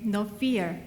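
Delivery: ordinary talk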